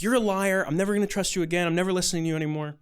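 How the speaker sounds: fast, high-pitched mock tirade; really pissed. Upset teen turning on his counselor, really pissed. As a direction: imitate a furious kid swearing off trust, rushed. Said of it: "he's, like, really pissed".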